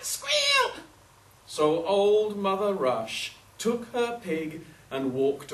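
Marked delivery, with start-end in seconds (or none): 0.0-0.8 very high, loud pig squeal; imitated pig squealing at full pitch. A narrator's reading. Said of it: "who was still squealing".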